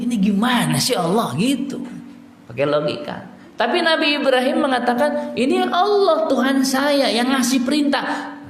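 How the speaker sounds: high, impassioned sermon voice; fervent preaching. Acted. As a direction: preach the story with rising fervour. Preaching the Ibrahim story.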